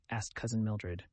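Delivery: ordinary delivery, nothing marked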